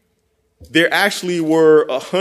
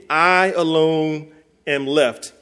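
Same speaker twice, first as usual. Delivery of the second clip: I alone am left, drawn out slowly; slow weighty lament; much slower than usual. Acted. Preacher mimics Elijah's refrain, slow and heavy. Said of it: "Here we go again".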